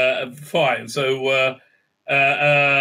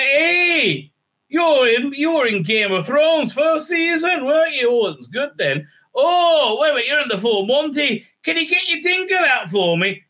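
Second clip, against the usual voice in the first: mock starstruck excitement; 10 semitones higher than usual. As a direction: gush like an over-eager fan, hammed up. Acted greeting of actor Mark Addy.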